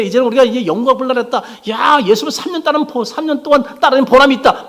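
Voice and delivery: high, loud elated disciples; giddy excitement. Retold or imitated. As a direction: act out the disciples getting carried away. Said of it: "막 이렇게 들떠 있는데".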